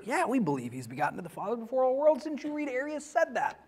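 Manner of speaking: soft, quick, smug mock-aside; snickering Arian. Teacher voices a smug Arian, soft and fast. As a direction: toss it off like a sly heretic dodging. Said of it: "picture the Arian snickering".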